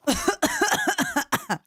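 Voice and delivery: harsh choking cough; coughing fit. Real, not acted. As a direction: cough hard, as if the sauce burns. Coughing after the hot sauce.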